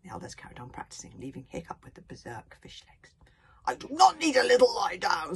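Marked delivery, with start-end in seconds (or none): 3.7-5.4 loud, high barked denial; barking protest. A narrator's reading. Fishlegs barks at Gobble, punching the air. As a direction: bark it while punching the air. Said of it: "said Fishlegs, punching the air".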